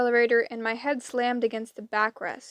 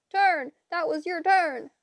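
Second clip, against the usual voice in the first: put-on high, insistent old-lady retort; shrill-edged bickering, raised pitch; squabbling; 6 semitones higher than usual. A narrator's reading. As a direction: shout down a sister in a petty quarrel. Sisters squabble while driving.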